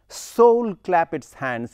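Soul leaned on, slow and full; slow stressed Soul at the line's start. Shown as a demonstration. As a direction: weigh down the word Soul. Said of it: "you have to emphasize the word soul".

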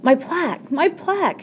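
high, wailing lament; sobbing. A narrator's reading. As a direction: wail it in mild mock sobs. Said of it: "she sobbed".

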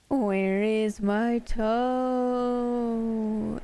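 long rising spooky moan; groaning ghostly voice. A narrator's reading. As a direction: drag the words out like a ghost closing in. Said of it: "it groaned".

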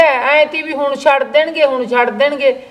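ordinary talk

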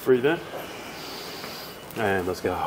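low, soft coaching voice for breathing; low soothing stretch coach; calm breathing cues. Acted. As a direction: guide the client through each breath, softly.

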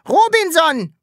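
high, loud shout of a name. A narrator's reading. Sams shouts its name at the landlady, loud. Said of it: "schrie das Sams".

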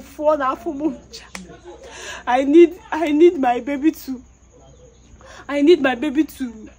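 normal talking voice

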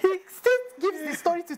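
ordinary talk, nothing marked

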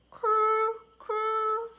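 high gentle coo; dove coo. Acted. Dove call in story.

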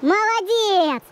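drawn-out praise. Real, not acted. Praising the dog after recall.

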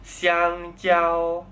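"香蕉" both syllables high and level; double first tone. Shown as a demonstration. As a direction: hold "香蕉" up high on one pitch. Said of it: "satu-satu nadanya".

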